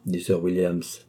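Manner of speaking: plain reading voice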